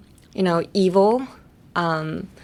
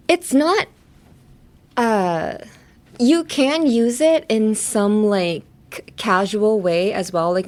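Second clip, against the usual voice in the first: ordinary delivery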